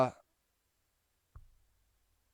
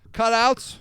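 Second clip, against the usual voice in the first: ordinary delivery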